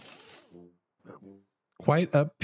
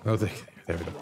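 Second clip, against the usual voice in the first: ordinary talk, nothing marked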